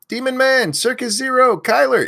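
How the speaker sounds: loud, high name call-outs; yelling names. Real, not acted. Host yelling chat names to early viewers, loud and high. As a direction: belt each viewer's name out. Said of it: "if you want me to yell your name".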